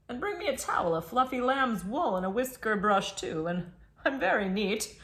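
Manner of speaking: put-on raccoon voice cracking into laughs; a demanding raccoon giving orders while the reader giggles; giggly raccoon voice. Raccoon orders a finger bowl from the child, laughing through it. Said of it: "he says to you".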